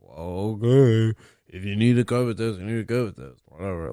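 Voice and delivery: mock indifferent. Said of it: "he was very kinda like".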